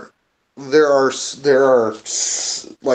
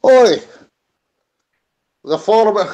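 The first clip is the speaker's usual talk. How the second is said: put-on Russian accent; 4 semitones higher than usual. Worker hails the guard at the mine, Russian accent. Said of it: "Using my Russian accent".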